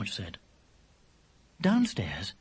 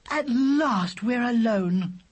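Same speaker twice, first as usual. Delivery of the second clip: slow, higher, theatrical line of a heroine; dramatic voice; 11 semitones higher, 4 dB louder, much slower than usual. A narrator's reading. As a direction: stretch it out like a melodrama. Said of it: "said Laura in a dramatic voice".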